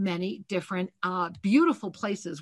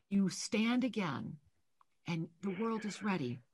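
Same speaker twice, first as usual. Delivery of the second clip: soft channeled reading; 7 dB quieter than usual. Acted. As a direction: lay the message on her gently, hushed. Psychic soul reading, soft channeling.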